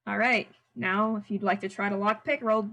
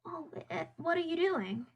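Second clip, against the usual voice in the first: character voice; 9 semitones higher, 4 dB quieter than usual. Acted. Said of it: "And Nim says".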